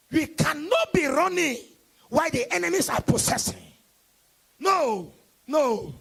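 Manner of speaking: loud call and response sermon; fiery preaching. Acted. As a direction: hammer each line to the congregation. Preaching at full force.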